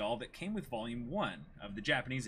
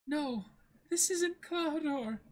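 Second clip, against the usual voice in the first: put-on high, shaken young woman's voice; Kaya, upset girl; 14 semitones higher, slower than usual. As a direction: blurt it out, voice pitched up.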